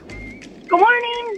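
high, drawn-out, perky greeting; chipper and perky. Real, not acted. Wife calling in to the morning show, chipper and perky. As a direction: sing out a cheery hello, very high and slow. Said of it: "you sound all chipper and perky".

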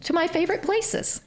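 high, bubbly and thrilled; gushing excitement. Retold or imitated. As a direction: squeal it like a delighted shopper. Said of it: "I stood up and I said".

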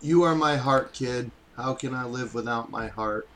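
low, soft mock-tender; whisper-tender to the coke. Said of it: "That was to the coke".

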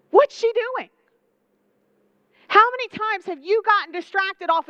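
high, loud indignant question; indignant outburst. Retold or imitated. Martha's jealousy toward Mary exploding in a high, loud, indignant demand. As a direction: let the resentment burst out.

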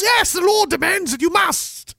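very high falsetto insistence; castle maidens. Acted. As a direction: insist in a hammed-up maiden squeal. Acting castle women's insistence.

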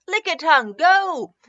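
high, loud trainer's call; battle cry. Acted. Jessie sending out her Pokemon, high and loud. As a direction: shout it as you throw the ball.

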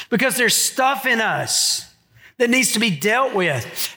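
ordinary delivery

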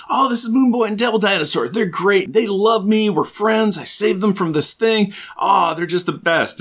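high, fast, excited gushing about his dino friends; gushing Reptile. Retold or imitated. Reptile bragging to everyone about his dinosaur friends, high and giddy. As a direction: chirp it out like a proud kid showing off his pets. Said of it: "With Reptile like".

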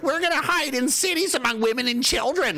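high, silly mimicking voice; mocking quote. Voices the opposing side, mocking.

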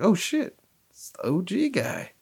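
high surprised squeak, then dropping; oh no, it's him. Retold or imitated. Surprised realising it's the old driver.

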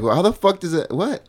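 put-on high, shocked girls' voice, lightly done; surprised girls. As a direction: pitch up in baffled disbelief. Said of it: "the girls are like".

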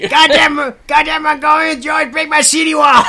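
put-on high loud shout, Chinese accent. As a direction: rage at the Mongolians, Chinese accent. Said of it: "I would have paid for a two-hour version of that guy".

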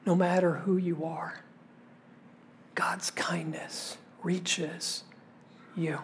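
near-whisper, very soft and slowing; hushed reassurance. Real, not acted. Preacher assuring each listener of God's kindness, hushed.

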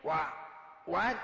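startled. Acted. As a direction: jump at the interruption. Max startled by cellmate.

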